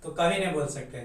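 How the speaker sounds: usual speaking voice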